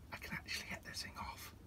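quick hushed whisper. Real, not acted. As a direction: whisper it under your breath. Whispered while struggling with ring.

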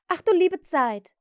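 put-on Wendy cries out at the children; exclaiming. A narrator's reading. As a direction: throw up your hands in mock despair. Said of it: "rief Wendy".

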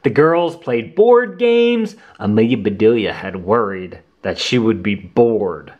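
ordinary delivery, nothing marked

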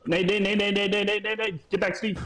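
high urgent repeated warning; grenade warning yell. Real, not acted. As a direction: shout the warning fast and high. Grenade incoming in firefight.

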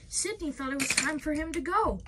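plain reading voice

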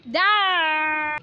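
long loud yell; power up scream. Real, not acted. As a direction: belt out a sustained shout. Sudden loud shout.

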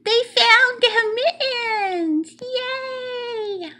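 high, drawn-out cheer; delighted cheer. A narrator's reading. Reader cheering the found mittens, high and delighted. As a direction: celebrate slowly in a high, elated voice. Celebrating the kittens finding mittens.

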